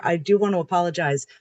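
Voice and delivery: normal talking voice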